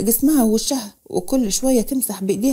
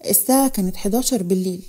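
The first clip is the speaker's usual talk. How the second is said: ordinary delivery, nothing marked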